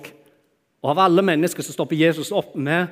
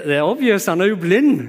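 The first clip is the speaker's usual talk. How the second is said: loud, higher, exasperated aside; obviously; 6 semitones higher, 8 dB louder than usual. Retold or imitated. Preacher voicing his own thought to Jesus, loud and incredulous. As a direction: blurt it as if it's obvious. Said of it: "Og jeg tenker".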